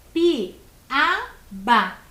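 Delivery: Piaba split as PI-A-BA, each beat loud; syllable by syllable. Shown as a demonstration. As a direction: count out Piaba in three separate syllables. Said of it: "também tem três sílabas". (Piaba separado em três sílabas, com pausas)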